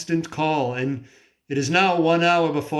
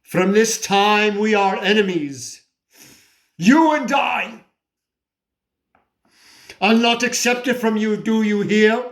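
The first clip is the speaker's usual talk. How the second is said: raised, high-pitched challenge; defiant declaration; 4 semitones higher, 3 dB louder than usual. Acted. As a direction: throw down a furious challenge to God. Declares war on God.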